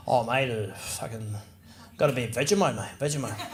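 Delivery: low, subdued Aussie reply; quiet, low-pitched Aussie bloke; Australian mate. Acted. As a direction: answer low and offhand as an Australian. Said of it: "the typical food of Australia".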